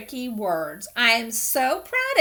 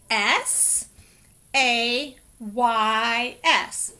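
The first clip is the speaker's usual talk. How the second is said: "S A Y S" spelled very slowly, then "says"; spelling aloud; much slower than usual. Shown as a demonstration. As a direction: spell each letter out slowly. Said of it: "to write the word says".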